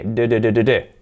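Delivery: "d" repeated as short voiced taps; D drill. Shown as a demonstration. Teacher drilling the D, repeated sound. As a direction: tap out "d" with voicing. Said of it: "y la D".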